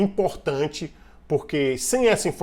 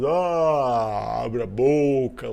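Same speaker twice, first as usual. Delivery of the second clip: imitated yawn; 3 dB louder, much slower than usual. Shown as a demonstration. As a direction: fake yawn stretched slowly over the words. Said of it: "não foi natural. Eu tô imitando". (bocejo imitado, de propósito)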